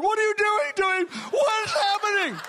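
high, loud panicked shout; frantic crying wife. Retold or imitated. Wife alarmed as her husband shakes, loud frantic pleading. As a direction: cry out in alarm for your husband. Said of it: "the wife starts crying".

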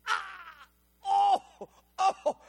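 high pained cry of agony. Retold or imitated. Waking up crying out in pain. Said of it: "I'm wake up, I go".

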